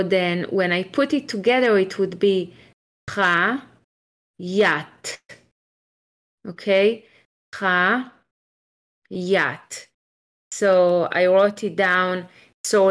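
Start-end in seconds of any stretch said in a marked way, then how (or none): 3.1-5.2 syllable by syllable, with a pause between; staccato. Shown as a demonstration. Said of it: "very slowly and show everyone how I'm doing it syllable by syllable". 7.5-9.9 syllable by syllable, with a pause between; staccato. Shown as a demonstration. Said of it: "very slowly and show everyone how I'm doing it syllable by syllable".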